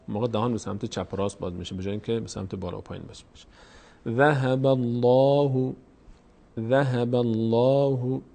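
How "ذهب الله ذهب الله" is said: slow recited "ذهب الله", dark rounded "lo"; full, heavy Allah. Shown as a demonstration. Teacher repeats correct reading for learners, heavy "l". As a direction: hold a heavy long vowel on "الله" in "ذهب الله".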